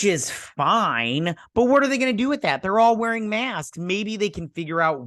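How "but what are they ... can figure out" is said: rising high and fast in disbelief; exasperated. Real, not acted. Viewer ranting about the useless owl photos, exasperated. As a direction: scoff at the plot's logic.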